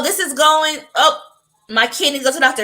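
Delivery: normal talking voice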